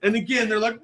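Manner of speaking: ordinary talk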